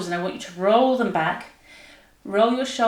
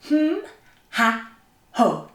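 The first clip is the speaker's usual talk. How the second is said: diaphragm exercise sounds; 2 semitones higher, slower than usual. Shown as a demonstration. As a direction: punch each sound from the diaphragm, stepping down. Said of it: "get our diaphragm working".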